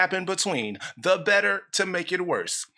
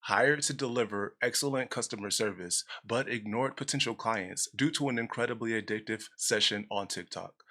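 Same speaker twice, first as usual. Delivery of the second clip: low, soft confiding read; hushed aside; 7 semitones lower, 7 dB quieter than usual. Acted. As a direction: tuck it under your breath like a secret. Reading a mock resume entry.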